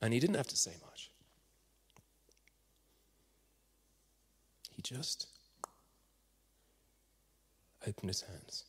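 very soft, fading into a whisper; hushed storytelling. A narrator's reading. Preacher reveals the rescuer's burnt hands, hushed. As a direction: let long pauses hang, almost whispering.

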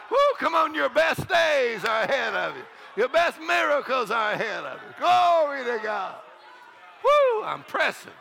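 high whooping exhortation; whoop, then proclaim it to rouse the crowd; exultant full-force revival delivery; jubilant preaching. Real, not acted.

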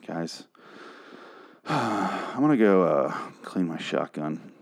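low, quiet, worn-out resignation; drained and softly fed up; defeated. Real, not acted. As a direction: sigh and give up, voice dropping away. Worn-out reviewer gives up on show.

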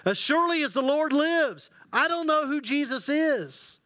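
high, heated denial; desperate oath. Acted. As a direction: swear it to get them off your back. Peter swears an oath.

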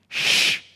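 one long hard shush. Acted. Scornful crowd hushing the blind men, shushing. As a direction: shush them firmly. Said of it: "those crowds going".

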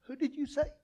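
hushed, halting double-take as Naomi; stop, then softly echo the name in disbelief; mildly acted astonishment. Naomi hearing the name from Ruth, understated astonishment. Naomi's stunned reply in story.

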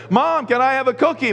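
put-on high childish whine asking mom; lightly voiced little kid begging. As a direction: play a small kid pleading for a cookie.

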